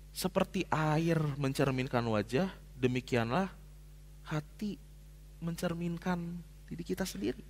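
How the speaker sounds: slow, soft reading of the verse; scripture reading. Acted. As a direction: let the proverb land slowly and quietly. Reads Proverbs verse. (membaca ayat Amsal dengan pelan dan lembut)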